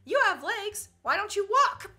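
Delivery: retold Mexican lady. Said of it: "little Mexican lady told her".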